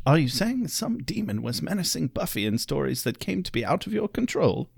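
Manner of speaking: a tired, hoarse-ish English mentor; strained Giles voice. Retold or imitated. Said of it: "I could get that more raspy Giles impersonation going".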